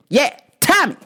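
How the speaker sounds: short high-pitched yelled name; mock yelling customer. Customer demanding his order by name, mock yelling. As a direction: yell it like an oddball customer. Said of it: "why are you yelling".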